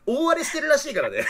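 loud, high laughing remark. Real, not acted. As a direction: blurt it out laughing. Host laughing about chaos.

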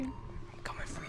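menacing whisper. Acted. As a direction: breathe it as a threat. Hunter whispers menacing threat.

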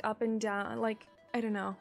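ordinary talk, nothing marked

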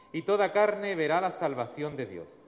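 usual speaking voice

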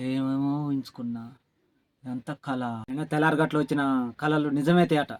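son-in-law mumbling to himself about the keys, softly scheming; muttering to himself. Acted. Waking, muttering greedy dream.

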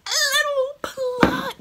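very high, loud, drawn-out squeal; screeched repeat. Retold or imitated. Vlogger reacting to the little pluck, screeched repeat. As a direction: shriek it slowly, hammed up.

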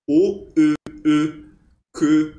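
from "O" into "E" with the lips kept rounded; rounded German ö. Shown as a demonstration. Teacher demonstrating the sound for students, rounded ö. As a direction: pout an O and say "E" through it, punched. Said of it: "mantém a boca do E e fala um E".

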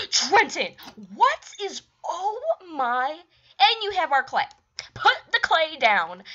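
gasping, high and loud alarm; sudden shocked cry at the intruder; shocked outburst. Real, not acted. Player catching an intruder with the clay, shocked and loud.

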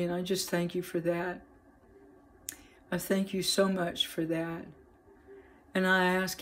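soft, hushed personal prayer to God. Real, not acted. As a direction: pray quietly and earnestly, speaking to the Father. Opens the video in prayer.

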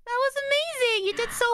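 gushing praise. Real, not acted. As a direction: squeal how amazing it was. Praising partner's song.

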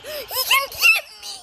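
panicked laughing scream; shrieking while fleeing in a game; being chased. Real, not acted. Is he still chasing me?.

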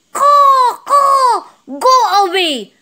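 high, loud cawing crow; high-pitched crow voice, drawn-out caws. Acted. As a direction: squawk it out high like an angry crow chasing someone off. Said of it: "He has a very loud voice".

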